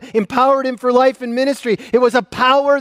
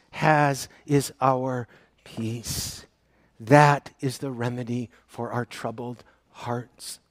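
slow, much softer and lower; tender close; 10 semitones lower, 8 dB quieter, slower than usual. Real, not acted. A pastor gently comforting the congregation about their troubled hearts. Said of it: "tender close".

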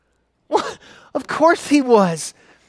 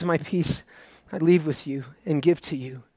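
much softer, low quoting of Jesus; hushed promise; 6 semitones lower, 8 dB quieter than usual. Acted. A preacher softly quoting Jesus's promise of peace to the church.

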